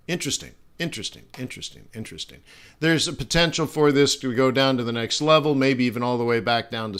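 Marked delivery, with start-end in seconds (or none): 0.1-2.4 musing repetition. Real, not acted. Musing over a chart.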